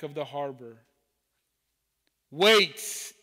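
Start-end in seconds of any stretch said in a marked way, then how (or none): 2.3-3.1 a sharp, loud call to stop them; small boat calling after the tugboat, loud; yelled. Acted. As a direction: shout it to halt the boats leaving. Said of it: "yelled the small boat".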